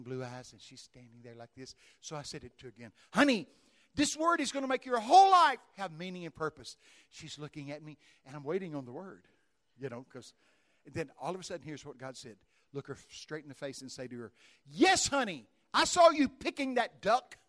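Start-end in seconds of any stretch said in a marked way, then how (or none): 3.1-6.8 much higher and louder, bursting with excitement; excited calling. Retold or imitated. Preacher thrilled, calling the prophecy to the old lady. 14.7-17.4 booming. Retold or imitated. God's odd word, voiced loud.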